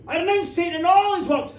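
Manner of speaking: loud, high, sudden outburst; blurted out. A narrator's reading. Janet pressed by minister to renounce Satan, blurting it out. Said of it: "blurted it out".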